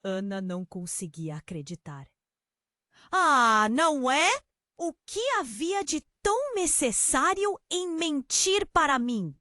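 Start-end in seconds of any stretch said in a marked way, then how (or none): 3.1-9.3 higher, pointed angry questioning; raiva. A narrator's reading. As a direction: confront them with rising anger. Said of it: "só aumentava a raiva dela". (confronto com raiva, voz mais aguda)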